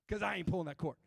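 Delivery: ordinary delivery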